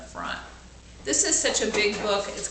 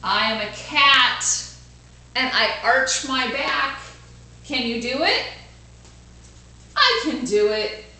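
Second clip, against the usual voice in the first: put-on bright, louder storybook reading for kids; lively read-aloud; 3 semitones higher, 6 dB louder than usual. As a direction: read it out big for the class.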